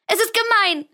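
put-on a heartbroken girl sobbing at her father; tearful cry. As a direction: cry it out in despair. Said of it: "schluchzte sie".

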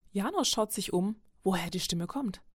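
ordinary delivery, nothing marked

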